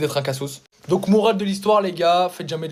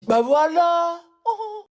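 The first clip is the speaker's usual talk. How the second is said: mock exclamation; 12 semitones higher, much slower than usual. Mock reaction.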